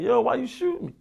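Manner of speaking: imitating his own disbelief; mock stunned yo; retold shock. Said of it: "looked behind me like".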